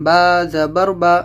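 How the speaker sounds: slow spelling drill. Shown as a demonstration. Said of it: "اس کو لمبا بالکل بھی نہیں کرنا ہے".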